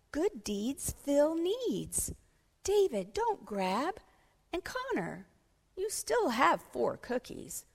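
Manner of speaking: put-on lilting voice of a child reasoning with friends; earnest schoolboy peacemaker. Pablo settles his friends' cookie squabble, earnest schoolboy. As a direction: play a boy gently setting his friends straight.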